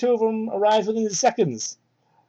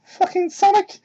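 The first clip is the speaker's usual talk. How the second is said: mock character shout; 13 semitones higher than usual. Reading bad fan fiction aloud, hammed-up shout. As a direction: ham up the angry rival voice.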